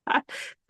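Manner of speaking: short burst of real laughter; amused laugh. Real, not acted. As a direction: crack up briefly at a funny line. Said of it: "Crack me up".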